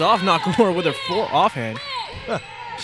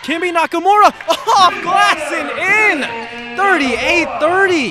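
higher and louder, stretched out slow with a laugh; excited call; 10 semitones higher, 5 dB louder, slower than usual. Real, not acted. Announcer calling Nakamura's three off the glass, delighted and laughing. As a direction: savor the bank shot going in.

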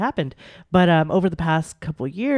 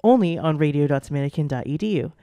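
upbeat radio sign-off, rushing the end; radio host; 4 dB louder, faster than usual. Acted. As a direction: close the show like a DJ.

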